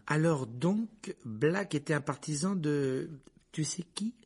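slow, careful, tentative question; apologetic tone. A narrator's reading. Passenger resuming after his blunder, apologetic. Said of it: "sur un ton d'excuse".

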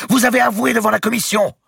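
very high, loud outburst; furious shout. A narrator's reading. Archbishop reminds grand master of his confession, furious shout. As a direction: shout the accusation in rage. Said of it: "cria-t-il au grand maître".

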